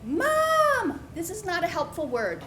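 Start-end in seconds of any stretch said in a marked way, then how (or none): 0.0-1.0 high yell for mom. Retold or imitated. Lost child calling to mother in the stands, high yell. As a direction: call up to the stands. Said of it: "I start yelling up".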